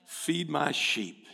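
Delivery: slow, weighty words of Jesus; slow Jesus command. Acted. Pastor voicing Jesus to the flock, slow and solemn. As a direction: lay each word down slowly. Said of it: "what did Jesus tell them to do".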